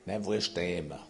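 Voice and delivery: low, soft Swiss French reply; Swiss accent. Acted. Husband answering his wife, low and soft in a Swiss accent. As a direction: answer quietly with a Swiss lilt. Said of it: "Je prends mon accent suisse".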